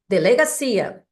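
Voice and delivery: ordinary delivery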